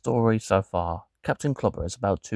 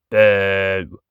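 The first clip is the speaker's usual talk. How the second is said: loud heckler's boo, in another voice; a put-on heckler booing loudly; 8 dB louder than usual. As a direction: boo him off the stage. Audience voice booing.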